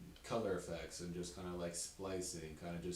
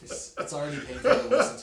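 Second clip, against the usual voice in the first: loud laughing complaint; 8 semitones higher, 16 dB louder than usual. Real, not acted. Comic laughing about how painful old sets are, laughing. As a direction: laugh through it, much louder.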